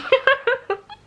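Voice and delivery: laughing through tears; a squeaky giggle while crying; embarrassed teary laugh; high teary laugh. Real, not acted. Laughing at herself over tears.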